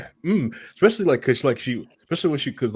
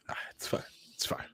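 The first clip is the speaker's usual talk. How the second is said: breathy whisper to himself; soft unvoiced aside; 14 dB quieter, much faster than usual. Real, not acted. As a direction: let it slip out under your breath. Muttering to himself after banter.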